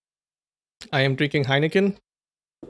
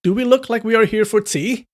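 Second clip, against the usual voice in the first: higher, louder sarcastic comeback; sharp retort; 7 semitones higher, 4 dB louder than usual. A narrator's reading. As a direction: scoff at the old man. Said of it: "Escort Zhang retorted".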